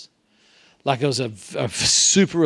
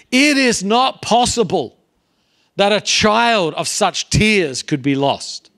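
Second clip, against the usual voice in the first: slow, loud and higher, an exasperated declaration; the bishop finally loses patience, slow and loud; 8 semitones higher, 7 dB louder, slower than usual. Retold or imitated. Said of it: "out of exasperation, the bishop says".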